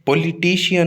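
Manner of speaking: पॉलिटिशियन as po-lee-TEE-shi-yan; full "lee" and split "shi-yan" in पॉलिटिशियन; common mispronunciation. Shown as a demonstration. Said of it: "नहीं होता". (पॉलिटिशियन का गलत उच्चारण "पोलीटीशियन")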